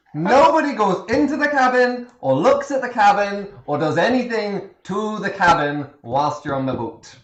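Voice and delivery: put-on Irish accent. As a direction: bark the cabin ban in a thick Irish accent. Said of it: "This, this is a good accent".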